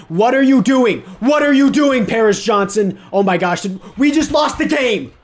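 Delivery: shouting in real disbelief, high and loud; furious yell. Real, not acted. Gamer yelling at his lineman who blew the block, furious. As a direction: yell at the screen as the game slips away. Lineman blows the final play.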